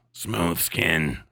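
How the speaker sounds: a mock-heroic low growl; deep mock voice. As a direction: drop way down into a booming low voice. Tribute to alopecia champions.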